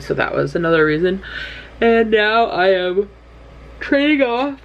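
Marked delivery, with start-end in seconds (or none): 1.8-4.6 higher-pitched, drawn-out yawning speech. Real, not acted. Vlogger updating viewers as a yawn takes over, yawning. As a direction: talk through a rising yawn. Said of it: "I don't know why I'm suddenly yawning".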